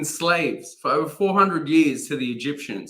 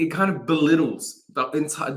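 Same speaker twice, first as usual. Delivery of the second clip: usual speaking voice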